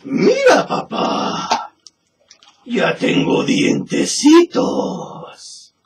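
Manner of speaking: put-on very high, slow, whispery demon-baby voice; creepy ghost baby. Ghost baby taunting the farmer who carries him, eerie high whisper. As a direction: breathe it out like an eerie infant monster.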